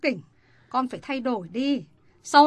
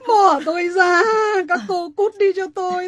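louder sobbing yell; crying shout; 7 semitones higher, 4 dB louder than usual. Acted. Mother pushing her daughters away, sobbing shout. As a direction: shove them off through tears.

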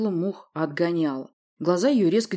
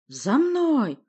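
high, raised rallying call; barked command; 9 semitones higher, 4 dB louder than usual. A narrator's reading. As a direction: call the soldiers to charge. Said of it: "рявкнул Урий".

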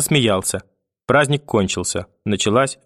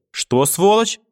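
high-pitched, furious challenge; outraged cry; 11 semitones higher than usual. A narrator's reading. Grandmother rounds on mother with the statuette, outraged cry. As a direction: explode in indignation, pitch leaping up. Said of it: "крикнула бабушка".

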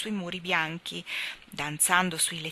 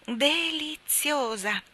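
slow, higher word on a contented sigh; happy sigh; 9 semitones higher, 4 dB louder, much slower than usual. A narrator's reading. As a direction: breathe it out in delight. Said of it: "con un sospiro felice".

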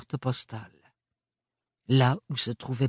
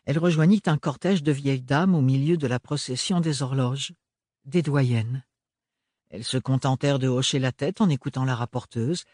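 ordinary talk, nothing marked

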